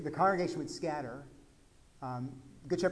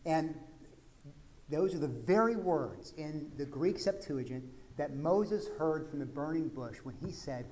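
ordinary delivery

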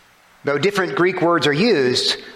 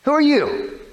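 irritated retort; 11 semitones higher than usual. Acted. Frustrated fisherman answers stranger.

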